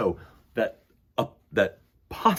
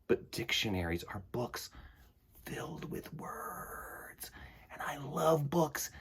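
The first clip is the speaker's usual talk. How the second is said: slow, reverent whisper about books; dramatic whisper; 14 dB quieter, slower than usual. Real, not acted. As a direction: whisper it like a treasured secret. Dramatic love of words.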